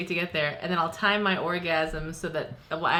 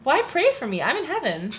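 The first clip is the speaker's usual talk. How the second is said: ordinary delivery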